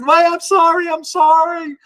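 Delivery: dog owner flustered apologizing to stranger; flustered woman. Retold or imitated. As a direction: squeak out a fluttery apology. Lady apologizing, coming out flustered.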